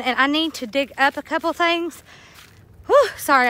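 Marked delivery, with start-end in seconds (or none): 2.9-3.5 breathless, puffing after the walk; catching breath between words. Real, not acted. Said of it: "I'm out of breath".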